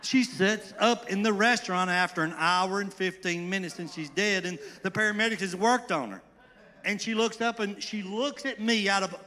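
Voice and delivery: loud, high, breathless telling of a miracle; almost shouting with amazement; excited. Real, not acted. Preacher tells church a dead woman sat up, excited shout.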